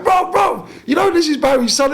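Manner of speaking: high, loud shout across the room; calling out. Retold or imitated. Funeral guests shouting to each other about Barry's son, high and loud.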